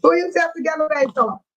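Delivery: ordinary delivery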